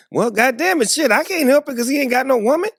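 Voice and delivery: high, loud helpless protest; hammed-up exasperation; mock exasperated excuse. Retold excuse to his wife.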